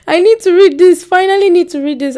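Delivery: high, loud burst of eagerness; excited. Real, not acted. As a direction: gush about the book, really wanting it. Gushing over a book she wants.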